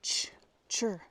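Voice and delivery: "Ch, chur" with a crisp ch; ch sound drill. Shown as a demonstration. Showing learners the ch in "Ch, chur". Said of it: "se pronuncia como una C H".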